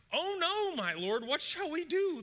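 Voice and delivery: high, loud cry of dismay; acted panic. Frightened servant crying to his master, in acted panic. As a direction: wail it out, slowing into a helpless question.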